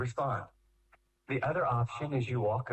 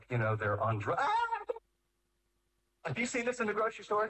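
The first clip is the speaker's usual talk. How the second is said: normal talking voice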